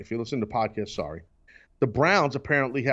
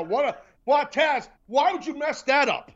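mock blame; 11 semitones higher than usual. Mock blaming co-host.

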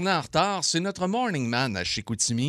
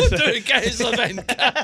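slow, laughing through the words; 7 semitones higher, slower than usual. Real, not acted. Host laughs at the beer perk, slow and laughing. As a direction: chuckle out the whole phrase, drawn out. Joking about free beer cases.

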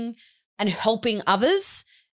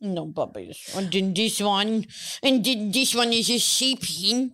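slow, cooing pet talk; dog and cat voice. Pretend. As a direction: coo at your pets like babies. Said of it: "that's my dog and cat voice".

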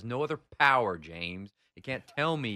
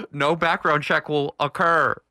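loud, slow, higher-pitched pronouncement; booming proclamation; 5 semitones higher, 12 dB louder, slower than usual. Real, not acted. Guest taunting James with his loophole, booming slow proclamation. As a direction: proclaim it like a grand verdict, word by word.

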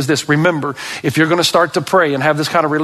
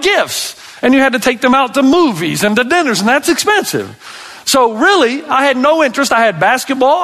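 fired-up preaching; 10 semitones higher than usual. Acted. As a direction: preach it with rising fire. Impassioned preaching, high and loud.

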